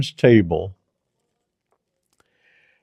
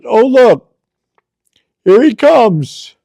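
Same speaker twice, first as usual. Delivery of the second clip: joyful surprise; 8 semitones higher, 4 dB louder than usual. Retold or imitated. Dying mother sees the Lord.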